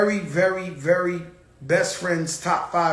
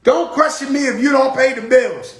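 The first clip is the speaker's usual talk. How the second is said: raised, higher mock-stern warning; loud scolding girlfriend; girlfriend's warning; 6 semitones higher, 6 dB louder than usual. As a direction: quote her laying down the law, louder. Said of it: "she said".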